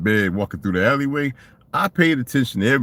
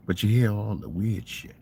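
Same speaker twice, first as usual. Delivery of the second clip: ordinary talk, nothing marked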